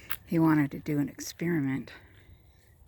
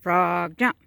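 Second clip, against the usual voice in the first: drawn-out frog, short louder jump; acted command; 8 dB louder than usual. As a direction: command a frog like a joke's mad scientist. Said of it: "commanded the frog to jump".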